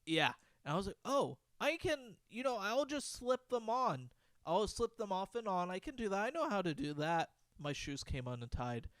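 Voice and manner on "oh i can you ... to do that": high, chirpy mock child; higher-pitched kid working it out; confident little kid. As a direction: reassure yourself like a small kid. Acts kid's confident solution.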